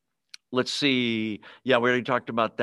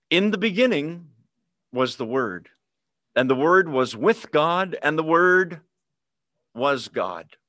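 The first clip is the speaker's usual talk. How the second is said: solemn, emphatic Bible reading; scripture reading; 2 semitones higher, 4 dB louder than usual. Acted. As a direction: lift the Word with weight. Reads scripture aloud.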